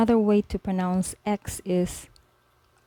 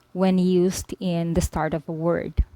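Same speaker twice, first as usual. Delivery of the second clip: ordinary talk, nothing marked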